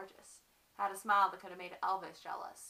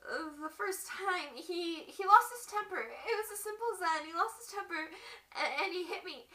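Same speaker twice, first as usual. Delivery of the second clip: higher, halting, shaken recollection; acted distress; 6 semitones higher, 9 dB louder, much faster than usual. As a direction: relive the shock in a raised, stumbling voice. Acts wife recalling first beating.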